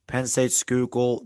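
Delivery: ordinary delivery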